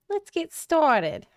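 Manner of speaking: high, drawn-out goblin voice. Pretend. Streamer trying out a practised character on viewers, goblin voice. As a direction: put on a slow, high-pitched goblin. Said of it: "I've been working on my goblin voice".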